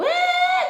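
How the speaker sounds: high, loud fake child wail; mock child wail. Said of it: "começou a chorar".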